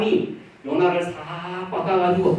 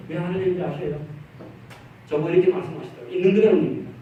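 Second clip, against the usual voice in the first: normal talking voice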